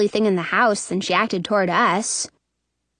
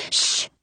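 sharp hushing sound; shushing hiss; 4 dB quieter than usual. A narrator's reading. Sister pinching brother to silence him, shushing. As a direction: hush the little brother.

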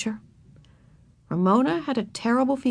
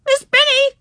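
much higher, loud tearful cry for the teacher; crying out near tears; 9 dB louder than usual. A narrator's reading. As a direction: wail for the teacher. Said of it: "cried Susan on the verge of tears".

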